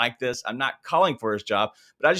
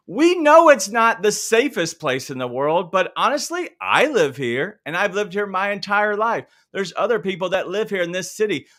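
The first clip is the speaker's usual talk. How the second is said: indignant outburst; 6 semitones higher, 4 dB louder than usual. Real, not acted. Heated defense of his home city.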